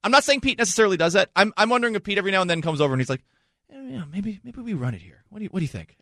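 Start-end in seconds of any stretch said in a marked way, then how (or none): 3.7-6.0 softly voiced, mildly hesitant coach; imitating a soft-spoken nudge; tentative suggestion. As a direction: float the idea quietly, as an aside. Imagined coach casually suggesting a play.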